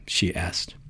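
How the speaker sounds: hushed whisper; breathy whispered aside; whispered narration. A narrator's reading.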